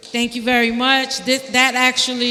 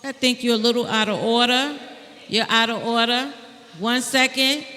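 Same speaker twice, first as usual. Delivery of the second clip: usual speaking voice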